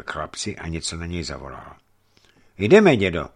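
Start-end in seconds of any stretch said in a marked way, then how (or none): 2.6-3.3 loud, firm and brisk; decide it for both of them. A narrator's reading. Dmitrij firmly telling the old man to go, loud and brisk. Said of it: "řekl rázně Dmitrij".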